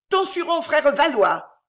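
high-pitched, acid jeer; sour voice. A narrator's reading. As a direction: throw it out sharply, high and sour. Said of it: "d'une voix aigre". (voix aigre et haut perchée)

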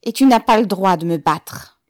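loud, indignant protest; forceful loud objection; defiant shout. A narrator's reading. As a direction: shout your refusal at him. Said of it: "cria Ribine en s'avançant vers lui".

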